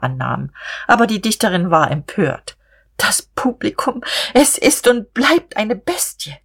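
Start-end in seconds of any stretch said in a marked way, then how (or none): 3.0-6.4 higher, slow, through the teeth; ground out. A narrator's reading. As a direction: grind it out in disgust, pitched up. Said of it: "knirschte sie".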